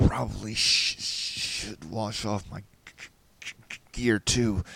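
shivering, snagging on sh and g; teeth-chattering stutter. Acted. Rescuer wanting his gear washed, shivering stutter. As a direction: stammer it out while freezing. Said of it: "my teeth chattering".

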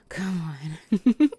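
loud laughing shout; laughing taunt. Acted. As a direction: taunt him through a burst of laughter.

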